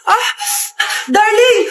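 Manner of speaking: normal talking voice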